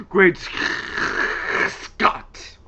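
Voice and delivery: loud, explosive mad-scientist exclamation; hammed-up Doc impression, drawn out and voiceless midway; Emmett Brown. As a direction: burst out high, rasp through a long broken gap. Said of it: "when he would act like Doc-, Doctor, uh, Emmett Brown".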